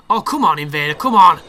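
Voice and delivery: building to a loud shout; frustrated yelling. Real, not acted. Gamer yelling at the Invader to come fight, rising to a shout.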